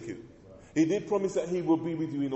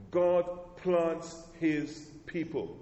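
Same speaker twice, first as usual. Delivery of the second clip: God plants his people drawn out very slowly; slow emphatic line; much slower than usual. Real, not acted. Preacher driving home his theme to the church, very slow. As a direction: spell out every word of the key line. Repeated key sermon line.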